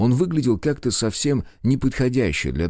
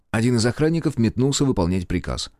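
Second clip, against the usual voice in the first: plain reading voice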